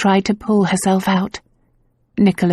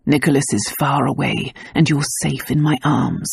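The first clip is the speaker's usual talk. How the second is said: soothing; 5 semitones lower, 3 dB quieter than usual. A narrator's reading. Lover soothing frightened woman.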